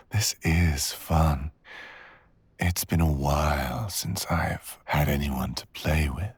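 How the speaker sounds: ordinary talk, nothing marked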